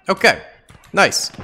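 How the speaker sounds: ordinary talk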